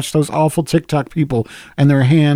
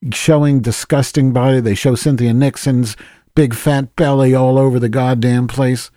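ordinary delivery

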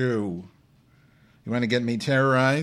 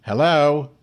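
loud, raised-pitch hello; calling out; 5 semitones higher, 6 dB louder than usual. Real, not acted. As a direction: call out to see if anyone is listening. Calling to silent chat room listeners.

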